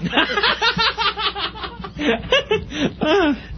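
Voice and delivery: long, high-pitched laughing fit; real laughter. Real, not acted. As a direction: crack up helplessly, high and long. Laughing at the mother-in-law clip.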